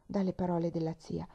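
ordinary delivery, nothing marked

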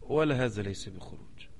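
soft, limp mock voice of a fence-sitting scholar; mock timid piety; mocking the hesitant pious man. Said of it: "ورع الأستاذ".

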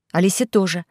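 usual speaking voice

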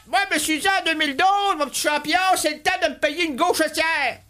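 high-pitched, excited hype; high, eager mock enthusiasm; acted excitement. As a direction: cheer on your champion in a high voice.

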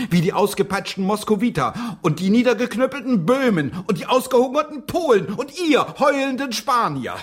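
fed up and letting it pour out; indignant outburst. Acted.

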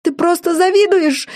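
put-on high-pitched girlish cry, understated in volume; upset high outburst; shrieked accusation. A narrator's reading. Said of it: "закричала Джорджина".

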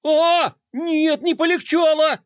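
high, loud terrified cry; panicked wail. A narrator's reading. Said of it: "завопил Флай".

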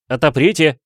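loud, commanding cry at the door; a detective yelling through a locked door; shouting. Acted. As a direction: bang out the order loudly. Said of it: "вскричал он".

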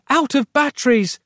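high-pitched exclamation; cried out. A narrator's reading. Roz finding the torch is dead, crying out. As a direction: cry out in dismay. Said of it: "she cried".

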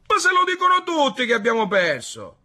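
loud, high-pitched objection falling at the end; indignant protest. A narrator's reading. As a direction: protest loudly to the doubters. Villagers argue about lost battle.

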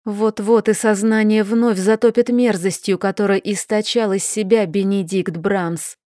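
plain reading voice

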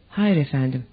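plain reading voice

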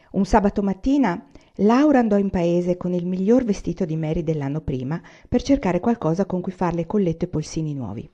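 usual speaking voice

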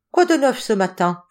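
a lightly acted office clerk; lightly voiced greeting. A narrator's reading. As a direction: toss out a brisk morning question. Character opens the office chat.

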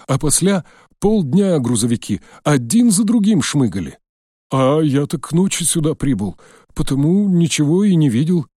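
put-on high, loud chattering old man; nervous old man. A narrator's reading. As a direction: babble like a scared old watchman.

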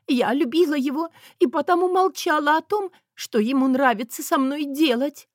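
high-pitched tearful play-acting; feigned sobbing. A narrator's reading. Wife stages a confession for the press, mock sobbing. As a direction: lament like a wronged, loving wife. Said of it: "тут же изобразила всхлип".

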